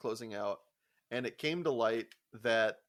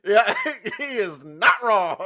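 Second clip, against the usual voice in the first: laughing; 9 semitones higher, 13 dB louder, slower than usual. Real, not acted. Cracking up at kid's logic.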